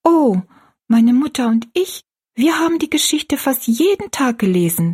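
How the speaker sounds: put-on high-pitched girl's character voice; earnest girl. A narrator's reading.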